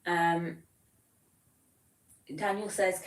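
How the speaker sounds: ordinary delivery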